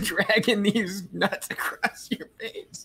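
high, slow, giggly delivery; laughing through words. Real, not acted. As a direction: draw it out while cracking up. Laughing through own crude joke.

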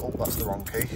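usual speaking voice

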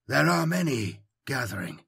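quiet, thin and certain; soft thin voice. A narrator's reading. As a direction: speak softly, with pure conviction. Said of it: "His voice is strangely soft".

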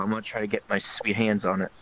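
ordinary delivery

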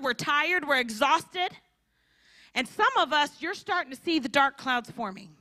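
ordinary delivery

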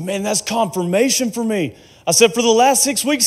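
high, amazed burst of recognition; acted-out eager confirmation; excited retelling. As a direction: blurt it out, pitched well up.

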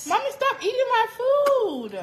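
high, drawn-out complaint; whining protest. Real, not acted. Daughter protests mother eating her food.

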